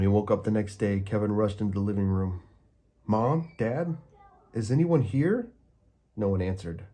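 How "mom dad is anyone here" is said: put-on high, louder child calling round the house. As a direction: call out for his parents. Kevin calls through empty house.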